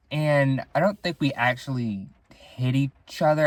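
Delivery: usual speaking voice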